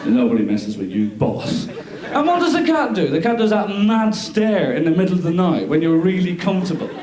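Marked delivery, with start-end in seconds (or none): 0.1-1.7 mock-tough reassurance from a dim dog; dopey loyal dog. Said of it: "looks at you going".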